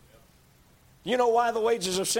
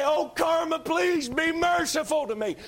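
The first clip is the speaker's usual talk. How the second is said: high, hammed-up begging prayer; mocking a whiny prayer; mock plea to karma; 8 semitones higher than usual. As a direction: mimic someone pleading with karma, pitched way up. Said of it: "pray to karma and say".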